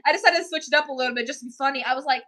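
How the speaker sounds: ordinary talk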